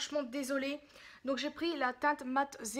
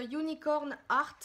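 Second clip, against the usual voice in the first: "Unicorn Art" in a heavy French accent; slow English with French sounds; 3 semitones higher, 4 dB louder, slower than usual. Real, not acted. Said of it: "désolée pour l'accent horrible".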